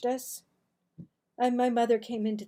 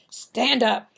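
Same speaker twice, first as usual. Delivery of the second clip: a stern father's order, louder; 9 dB louder than usual. Acted. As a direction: bark the order down at the boys. Angry father confronts hiding boys.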